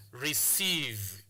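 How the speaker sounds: "receive" with a long, drawn-out ee; long vowel. Shown as a demonstration. Teacher showing students the vowel in receive, long vowel. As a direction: stretch the second syllable of "receive". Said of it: "it is pronounced as a long vowel".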